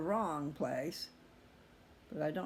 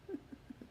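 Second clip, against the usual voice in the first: quiet chuckle; 9 dB quieter than usual. Real, not acted. Laughs calling self weirdo.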